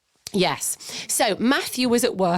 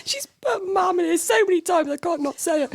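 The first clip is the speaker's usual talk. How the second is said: high, giggling voice that keeps cracking; fighting the giggles while reading; laughing; 12 semitones higher than usual. Real, not acted. Reading the letter aloud to her friend, helpless laughter. Both crack up over the mams.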